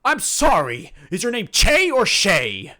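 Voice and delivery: big earnest himbo, loud and proud; dopey sweet himbo. Acted. Bang asks her name.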